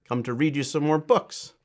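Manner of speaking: plain reading voice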